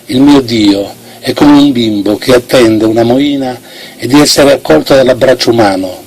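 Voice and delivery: plain reading voice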